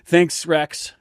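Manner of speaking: ordinary delivery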